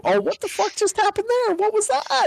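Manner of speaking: high, startled yelp; shocked outburst. Pretend. Player reacting to the botched bow shot, startled. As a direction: squeak it out in disbelief.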